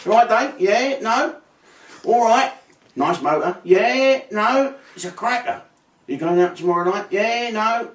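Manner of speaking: lightly voiced matey bloke; a hint of a cheery mate in the street; mock yes-no bloke. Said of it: "Dave Franks is one of them".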